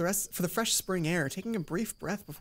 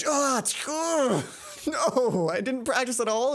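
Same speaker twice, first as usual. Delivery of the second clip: frustrated groan; 6 semitones higher, 3 dB louder, much slower than usual. Real, not acted. Player groans at own slip-up.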